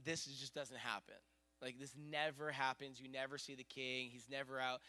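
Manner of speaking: hushed, low retelling of astonishment; disbelief. Retold or imitated. Locals telling a visitor this never happens, hushed disbelief. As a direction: insist on it softly, understated. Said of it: "they're freaking out 'cause they're like".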